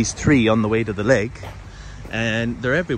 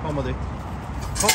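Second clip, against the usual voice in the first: coaxing the dog; 3 semitones higher, 6 dB quieter than usual. Real, not acted. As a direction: gently coax the dog to come. Coaxing dog to come back.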